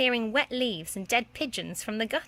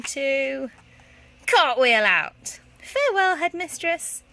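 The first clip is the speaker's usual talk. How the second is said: slow, louder build to a high farewell; grand flourish; 5 semitones higher, 7 dB louder, slower than usual. Acted. Petula taking a triumphant leave. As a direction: exit with a flourish.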